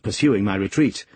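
ordinary delivery

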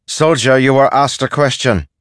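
high, loud demand to answer; sharp reprimand. Acted. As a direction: pull a soldier up short.

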